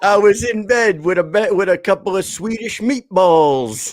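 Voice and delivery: high-pitched movie character impression; Andrew Dice Clay. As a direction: quote the film line in a high put-on voice. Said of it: "it's, uh, Andrew Dice Clay".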